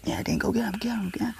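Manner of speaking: high egging-on voice, hushed; mock cheering friend. Friend urging me to go get him, high and hushed.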